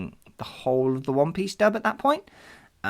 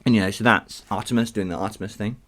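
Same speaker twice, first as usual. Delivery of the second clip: ordinary talk, nothing marked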